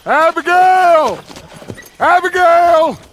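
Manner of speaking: calling out. Acted. Outlaw returning with the boy, calling loudly. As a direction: call out joyfully to her.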